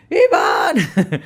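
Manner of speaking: high, loud calling of a name; mock maternal shout; emphatic mother. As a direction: imitate a mom calling her child to attention. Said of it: "Cuando quería remarcarme algo, hacía uso del énfasis".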